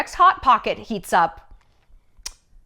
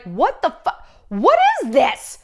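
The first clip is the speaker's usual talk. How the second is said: slow, then a much higher, louder "what is this?"; mock horror at being handed a rubber band; baffled disbelief; 4 semitones higher, 4 dB louder, slower than usual. Podcaster acting out getting a real rubber band, baffled. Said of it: "You're like".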